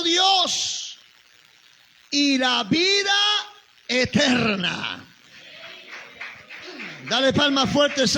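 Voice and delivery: ringing pulpit voice; preaching at full force. Acted. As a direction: proclaim it to the congregation. Preacher proclaims Jesus is God.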